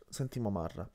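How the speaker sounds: ordinary delivery, nothing marked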